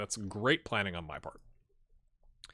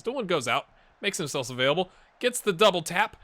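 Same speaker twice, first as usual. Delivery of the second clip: excited commentary; 9 semitones higher, 12 dB louder than usual. Real, not acted. Replay commentary of goal.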